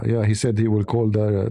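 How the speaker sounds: ordinary delivery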